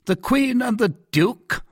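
startled, high-pitched question; cried out. A narrator's reading. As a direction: exclaim in sudden disbelief. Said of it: "cried Richelieu".

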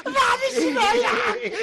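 high laughing apology; laughing plea. Acted. Guest laughingly begs the Hajj to stop. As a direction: beg off while still laughing.

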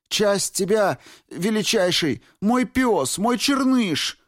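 high, loud desperate shout. A narrator's reading. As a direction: shout out the plea, voice straining. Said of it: "выкрикнул Рико".

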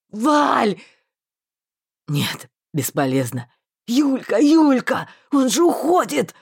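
loud, urgent calls; he is leaving, stop him; panicked shout. A narrator's reading. Marston begging friend not to go, panicked calls.

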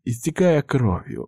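plain reading voice

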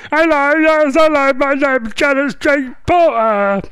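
high, drawn-out caricature voice; Janet Street Porter. Acted. Radio host showing off an impression, high caricature voice. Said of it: "I'm doing impressions this morning".

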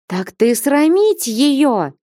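slow, high, indignant cry; indignant outcry. A narrator's reading. Flyonushka jumping up at Alexei, slow high indignant cry. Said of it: "вскликнула Флёнушка".

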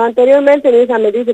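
normal talking voice

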